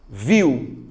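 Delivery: "Viu" with the I punched, sliding to a weak U; emphatic single-syllable VIU, weight on I; stronger I. Shown as a demonstration. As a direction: lean on the I in "Viu". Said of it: "A letra I é mais forte".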